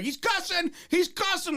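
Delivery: very high-pitched mock alarm; mock scandalized tattletale. Inmates tattling on him for cussing, mock scandalized cry. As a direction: tell on him in a squeaky, put-on panic.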